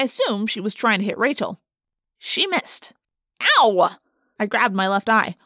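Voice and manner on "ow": sharp cry of pain. A narrator's reading. Hit in the eye.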